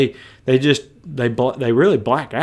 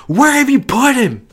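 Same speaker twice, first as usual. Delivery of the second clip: raised pitch angry question; deeper angry demand; 9 semitones higher than usual. Acted. Jesus demanding of mourners, mock angry. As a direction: ask it hot, with weight. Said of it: "It was probably deeper than that".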